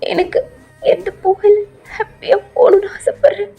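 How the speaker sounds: high, crying plea; acted weepy pleading, pitched high; tearful granddaughter. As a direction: comfort grandmother while crying yourself.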